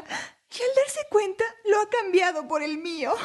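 high-pitched, tearful woman pleading her case; distressed mother accusing. Acted. Woman accusing her housemate before the king, distressed.

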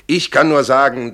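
insistent self-defence. Acted. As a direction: raise your voice to the court. Witness insisting before the court. (nachdrücklich beteuernd)

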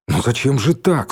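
ordinary delivery, nothing marked

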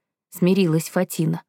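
usual speaking voice

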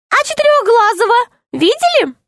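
high little gnome, anxious; tiny gnome voice. Acted. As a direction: squeak it out like a small worried gnome. Gnome asks. (тоненький голос гнома)

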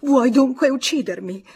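drawn-out gasp of relief into a loud reproach; high, overwrought outburst; quivering agitation. A narrator's reading. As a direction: cry out, overcome with emotion. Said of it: "balbettò fremente".

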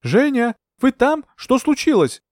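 high-pitched calling from the shore; worried shout. A narrator's reading. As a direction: call anxiously across the water. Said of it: "крикнул Скоробогатов".

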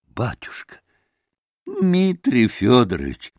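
lightly voiced pleading innkeeper; Трифон Борисович. A narrator's reading. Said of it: "возгласил Трифон Борисович".

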